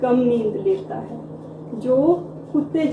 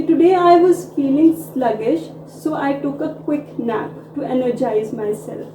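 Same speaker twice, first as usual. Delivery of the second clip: slow model sentence; much slower than usual. Shown as a demonstration. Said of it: "तो इसको English में हम बोलेंगे".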